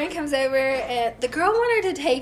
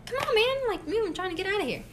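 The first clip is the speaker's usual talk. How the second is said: much higher, impatient plea to the driver; exasperated urging; 10 semitones higher, 5 dB quieter, faster than usual. Retold or imitated. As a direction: hurry the blocking car along, high and impatient. Blocked car, retold urging.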